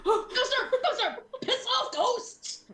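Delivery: high frantic cries, laughing; panicked yelling. Retold or imitated. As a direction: shriek at the ghosts. Retold panic at ghosts.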